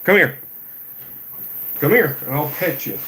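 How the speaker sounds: high, loud pet-calling voice. Real, not acted. Owner calls his new cat to be petted, high coaxing voice.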